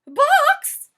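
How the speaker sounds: very high, loud shocked cry; shocked exclamation. Acted. Missus Rogers hearing the chicken is in a box, loud high shock. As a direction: shriek the word in alarm. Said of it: "exclaimed Missus Rogers".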